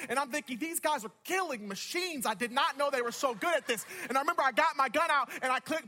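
loud, high-energy sermon storytelling; animated preaching. Real, not acted. Preacher telling the congregation a wild duck-hunt story, animated preaching. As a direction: punch out the tale with driving momentum.

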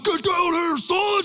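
high-pitched, loud parental shout; retold yelling. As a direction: re-enact an impatient dad's yell. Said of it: "I'm, I'm, I'm yelling".

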